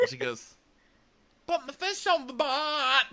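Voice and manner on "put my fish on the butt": very high squawked attempt at Kiwi; hammed-up, high-pitched bad accent; botched New Zealand accent. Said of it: "like a drunk Mary Poppins".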